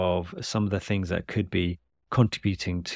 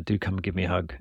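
normal talking voice

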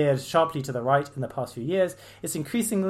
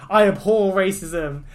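higher, louder, slow mocking quote; mock phrase; 6 semitones higher, 11 dB louder, slower than usual. Vlogger mocking stock denials, slow loud sneer. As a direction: ham up the empty phrase. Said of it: "racist person bingo".

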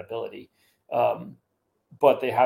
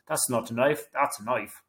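badly faked Australian accent, Crocodile Dundee style. Guest quoting Crocodile Dundee about knives, bad Australian accent. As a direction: try a clumsy Australian movie-quote accent. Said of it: "that was the worst Australian accent ever".

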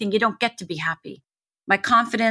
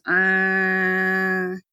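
long, steady vocal drone like a stuck horn; imitated car horn. Daughter recalling the endless horn after the shooting, imitated drone. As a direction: hold one unwavering honking note. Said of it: "lands in the car horn".

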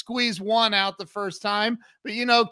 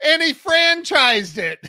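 slow, higher and louder through real laughter; laughing; 7 semitones higher, 6 dB louder than usual. Real, not acted. Telling his buddy's franchise punchline, laughing out loud. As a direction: laugh the words out, cracking up.

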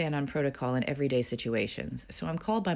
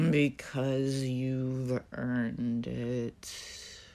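overdramatic sigh; 3 semitones lower, 3 dB quieter, much slower than usual. Retold or imitated. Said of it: "Uber dramatic".

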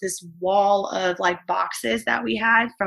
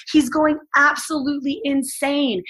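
ordinary talk, nothing marked

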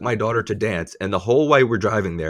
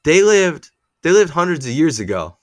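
put-on child voice; 6 semitones higher, 4 dB louder than usual. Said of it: "And he said".

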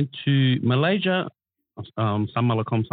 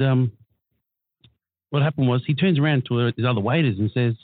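normal talking voice

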